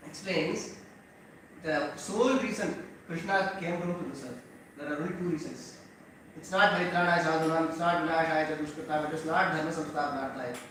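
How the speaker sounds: usual speaking voice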